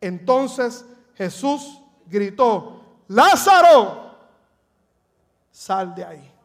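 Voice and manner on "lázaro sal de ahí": a loud first call, then dropping off; first take of Jesus calling Lazarus from the tomb. Shown as a demonstration. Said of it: "Debo decir".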